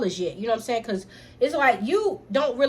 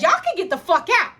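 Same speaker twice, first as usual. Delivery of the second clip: high, loud get-out order; furious yell; 7 semitones higher, 5 dB louder than usual. Retold or imitated. Sister ordering brother out, loud and furious. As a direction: throw them out at full voice. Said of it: "I literally lost my motherfucking mind".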